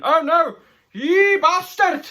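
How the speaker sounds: mock outraged cry. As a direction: squeal the insult as you're knocked away. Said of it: "struck son cries out".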